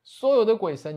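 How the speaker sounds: a grieving parent calling out to heaven, loudest at the end; crying out to the gods. Acted. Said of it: "大声的哭喊".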